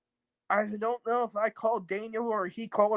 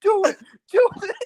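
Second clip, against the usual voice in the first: screaming high and loud, mocking; hammed-up tantrum yell; imitated shout; 11 semitones higher, 6 dB louder than usual. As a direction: yell it like a furious brat daring someone. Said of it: "He's like, he's like".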